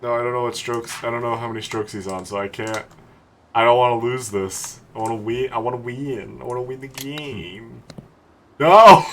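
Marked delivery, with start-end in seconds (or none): 1.4-7.8 low, fading, softer murmur; dejected mumble. Real, not acted. Dejected, muttering about winning. 8.6-9.0 loud burst of dismay; frustrated shout. Real, not acted. Missed putt.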